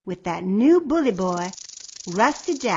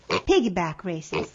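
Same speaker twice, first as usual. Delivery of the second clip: plain reading voice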